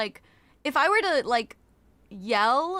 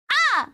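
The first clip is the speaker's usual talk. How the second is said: short, high, loud scream; sudden test scream, "Ah!"; demo scream; 7 dB louder than usual. Shown as a demonstration. Streamer demonstrating a scream for an audio check, short scream. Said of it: "I'm gonna scream".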